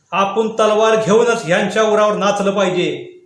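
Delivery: loud, fierce call to arms; rallying cry. A narrator's reading. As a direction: rouse the whole crowd to rise. Defiant call, then repeated.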